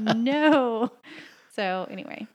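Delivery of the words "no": loud, drawn-out mock outrage; mock outraged. Wife reacting to being corrected, loud mock seething. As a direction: refuse loudly, playing up the fury. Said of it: "I'm seething over here".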